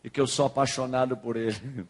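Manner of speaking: low, measured delivery of a message from God; a hint of God speaking, low and unhurried; God's voice, lightly voiced. God sending the pastor with a message of love, lightly voiced low and solemn. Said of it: "Deus falou assim".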